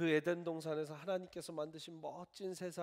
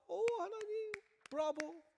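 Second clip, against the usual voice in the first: much higher, thrilled bravo; ecstatic cheer; slower than usual. Pretend. Eve cheering God for creation, ecstatic and much higher. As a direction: squeal with delight at God.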